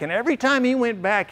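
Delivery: ordinary talk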